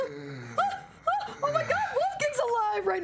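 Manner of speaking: gasping, high-pitched disbelief; shocked gasp. Real, not acted. Streamer reacting to the game character, high excited gasps.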